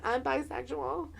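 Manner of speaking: soft, tentative admission, retold; acted quiet coming-out to a friend; hushed confession.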